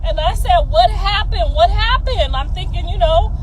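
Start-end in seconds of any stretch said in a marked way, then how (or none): 0.7-2.4 alarmed questioning. Retold or imitated. Retelling her own worried questions.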